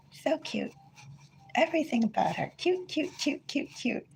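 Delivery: high soft gushing, fading to a breath; gushing cute. Real, not acted. Owner gushing over her cat, soft high fading coo. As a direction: coo the repeated cute, trailing off softly.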